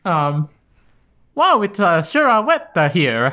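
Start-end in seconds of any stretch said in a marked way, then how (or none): 1.4-3.3 high, hesitant attempt at an Italian accent. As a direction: fumble through a mock Italian accent. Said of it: "Can you give me your best Italian accent?".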